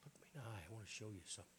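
lightly voiced Jesus, softly inviting; gentle invitation. Preacher voicing Jesus inviting a believer closer, soft.

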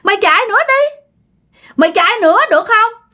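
put-on high, quick sinister old woman; menacing high-pitched hag; taunting ghost hag. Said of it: "Bà mới cười, bà nói".

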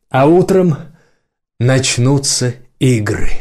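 rally the guests to celebrate; grand festive speech. Acted.

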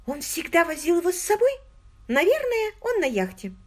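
put-on high, eager girlish voice; an excited young woman confiding. A narrator's reading. Margot voiced by the narrator.

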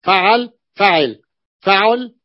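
فعل said slowly and loudly, each vowel spelled out; teacher drills فعل slowly and loudly for the students; pattern word drilled. Shown as a demonstration. Teacher drilling students on the pattern, slow and loud. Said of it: "الفاء فعل".

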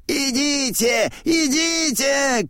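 high, drawn-out calling; old man's high-pitched shout. A narrator's reading. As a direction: call out high to gather a crowd. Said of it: "кричит старик".

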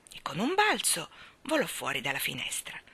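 ordinary delivery

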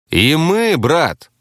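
indignant, high-pitched protest. A narrator's reading. Taxi driver protests he would save others, indignant. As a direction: protest hotly, pitch jumping up. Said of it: "возмутился таксист".

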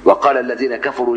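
ordinary talk

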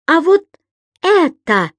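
high, emphatic accusation; acted outraged challenge; indignant. A narrator's reading. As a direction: confront him with the evidence, punching each phrase. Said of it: "негодовала госпожа Брюкман".

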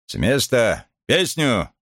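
high barked marching commands; shouting drill sergeant. A narrator's reading. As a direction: call the squad to sing. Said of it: "Старшина скомандовал".

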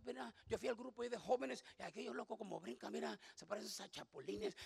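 scoffing under the breath; old scoffing self. Retold or imitated. The listener mocks the jumping youth group, soft sneer. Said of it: "usted era que decía".

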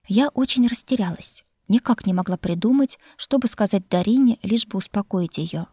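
usual speaking voice

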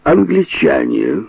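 plain reading voice

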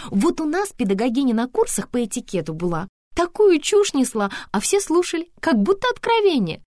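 high, loud, emphatic gossip; chatty Antonina, scornful. Acted. Antonina mocking her etiquette teacher, high and emphatic.

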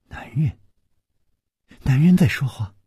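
astonished questions; can't believe a man is there; startled. A narrator's reading. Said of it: "南平也吃惊地说". (吃惊地追问)

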